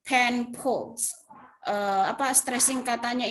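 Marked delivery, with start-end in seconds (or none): 0.0-1.1 "pen pals" with the weight thrown on "pen"; haphazard word stress. Shown as a demonstration. Teacher showing students careless stressing, misplaced emphasis. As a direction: punch "pen", let "pals" drop away. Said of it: "stressingnya itu pokoknya sembarang aja".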